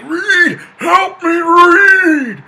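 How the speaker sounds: very high, loud agonized scream; screaming in pain. Acted. Ben calling Reed through the vent, agonized screaming. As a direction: scream desperately for help, far above normal pitch. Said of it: "he's, like, screaming".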